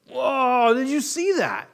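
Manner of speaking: like you just saw a miracle; amazed disciple. Retold or imitated. Disciples seeing the fish fill baskets, loud amazed wonder. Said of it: "you'd be like".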